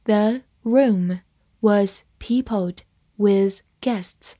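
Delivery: very slow, word by word with pauses; slow read-along. Shown as a demonstration. Teacher models the sentence for students to follow, slow and separated. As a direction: read it slowly, separating each word for learners. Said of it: "慢慢来读".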